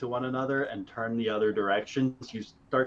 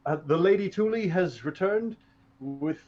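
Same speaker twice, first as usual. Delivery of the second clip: nervous soldier; 7 semitones higher than usual. Acted. Soldier addresses the king.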